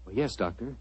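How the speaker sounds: usual speaking voice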